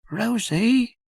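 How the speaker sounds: put-on high, puzzled little-boy voice; a small child wondering aloud; small boy Jim. Little Jim asks his sister why it's dark, high boy's voice.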